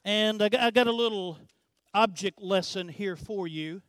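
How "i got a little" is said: ordinary delivery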